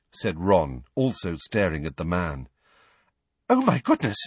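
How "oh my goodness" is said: high breathless astonishment. A narrator's reading. Girl suddenly spotting her old teacher, breathless. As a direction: gasp it out in sudden recognition. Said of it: "sounding breathless".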